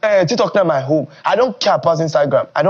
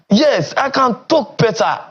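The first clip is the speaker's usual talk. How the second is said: ordinary talk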